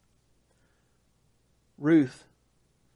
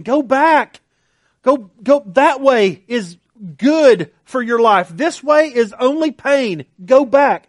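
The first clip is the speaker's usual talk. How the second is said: higher, louder pleading; pleading urge; 5 semitones higher, 5 dB louder, slower than usual. Retold or imitated. Naomi urging Ruth to go back, raised and pleading. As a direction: push her away with the voice.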